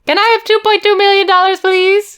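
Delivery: put-on high, loud, rushed little-boy begging; wheedling son. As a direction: blurt the request sweetly. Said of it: "let's reenact the phone call".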